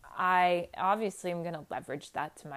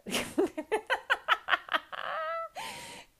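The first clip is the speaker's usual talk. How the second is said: high-pitched laughing fit. Real, not acted. Laughing at her own story about her sister, high real laugh. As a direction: burst of high, drawn-out laughter.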